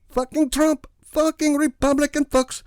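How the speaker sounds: put-on loud high Middle Eastern accented yell; Middle Eastern accent bellow. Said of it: "loudly bellowed with a Middle Eastern accent".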